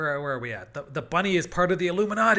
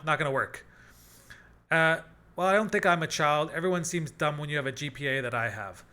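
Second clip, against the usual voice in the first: ordinary talk, nothing marked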